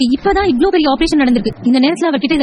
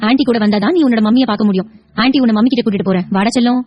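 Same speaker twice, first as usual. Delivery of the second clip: ordinary talk, nothing marked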